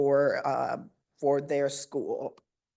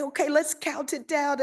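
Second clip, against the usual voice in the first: high, lively countdown cue; upbeat teacher cue; 9 semitones higher than usual. Retold or imitated. Principal starting the morning countdown with her students, high upbeat cue. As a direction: lead the kids into the count. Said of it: "And then I'll say".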